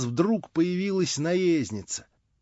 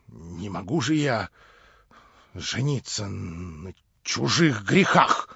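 тише и медленнее, с горечью; выговорить сдавленно и горько; горько, тихо; 9 semitones lower, 5 dB quieter, slower than usual. Acted. Горькое признание Степана Трофимовича. (тихая горечь старика)

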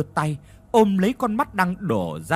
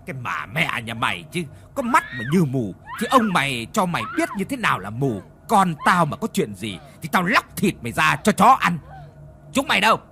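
snarling rage; 3 semitones higher than usual. Acted. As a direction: roar threats at the maid. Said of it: "Lão Thông gằn giọng lên".

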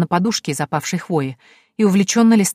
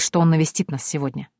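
usual speaking voice

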